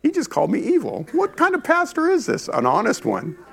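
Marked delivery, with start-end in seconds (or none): 0.0-2.5 high, indignant mock listener; mock offended listener. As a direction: play the offended churchgoer, pitched way up. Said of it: "You're like".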